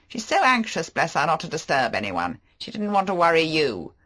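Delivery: a flashy charmer making excuses; Favell. Acted. Favell voiced.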